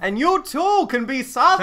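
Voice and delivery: high, loud showman's pitch; vaudeville voice. Pretend. Host hamming up a vaudeville pitch to his co-host, loud and high. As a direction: sell it like an old stage barker. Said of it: "That was my vaudeville voice".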